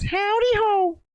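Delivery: a squeaky cartoon poo's greeting; Mr Hanky. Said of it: "Is it Towley that goes".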